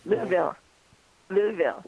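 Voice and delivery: Louisville as two syllables, LOO-vil; local pronunciation. Shown as a demonstration. Caller coaches hosts on local "Louisville", said LOO-vil. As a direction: say "Louisville" as LOO-vil, pausing between repeats. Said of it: "That's how they say it".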